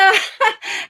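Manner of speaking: giggle. Real, not acted. Laughs mid-sentence about Roy.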